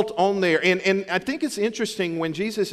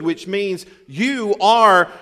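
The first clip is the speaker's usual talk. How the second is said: slow, louder "are"; stress on are; 3 semitones higher, 5 dB louder, much slower than usual. Shown as a demonstration. Pastor explaining the indicative mood, stressing "you are". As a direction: press "are" slowly and firmly. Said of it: "indicative mood".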